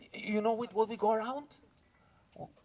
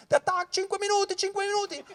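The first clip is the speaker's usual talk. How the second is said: Italian radio call; 13 semitones higher than usual. Acted. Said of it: "our radio communicator call".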